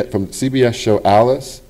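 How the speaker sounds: ordinary delivery, nothing marked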